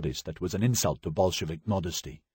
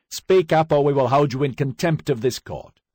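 loud, high final warning; stern judge; 8 semitones higher, 6 dB louder than usual. Acted. Judge warning the accused, loud and stern. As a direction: lay down the law from the bench.

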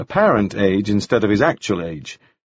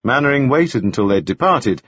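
ordinary delivery, nothing marked

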